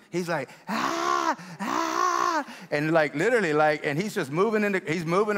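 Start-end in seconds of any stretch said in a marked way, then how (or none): 0.7-2.5 high, sustained imitation scream; mimicked dog scream. Preacher imitating a screaming dog to the crowd, high mock scream. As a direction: mimic a little dog screaming instead of barking. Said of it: "he starts screaming. He's not barking".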